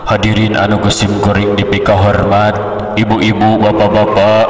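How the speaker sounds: measured formal address to the audience; level preacher's salutation with pauses; consistent dignified tone. Shown as a demonstration. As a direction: hold one steady tone, phrase by phrase. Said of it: "Gitunya konsisten ada nate".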